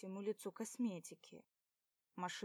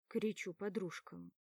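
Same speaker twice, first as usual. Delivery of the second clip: plain reading voice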